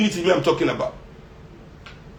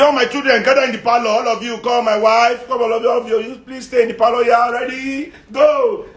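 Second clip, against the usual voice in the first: mock rich man summoning; 6 semitones higher than usual. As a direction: call the whole family in like a patriarch. Rich man calling household.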